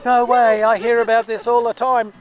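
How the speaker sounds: high, loud mock outburst of amazement; retold excited exclamation, high-pitched throughout; excitedly going off. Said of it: "started going off her cruet".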